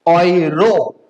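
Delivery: ऑयरो slow, as "oy-ro"; ऑयरो with "oy" opening and long final o; German EU example. Shown as a demonstration. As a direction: draw out both halves of ऑयरो. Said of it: "उसका pronunciation क्या होगा".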